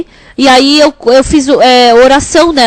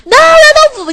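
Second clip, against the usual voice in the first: much higher, sharp cry of no; defiant refusal; 13 semitones higher than usual. Acted. The woman refusing Satan's voice, high and defiant.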